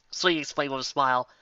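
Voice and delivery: ordinary delivery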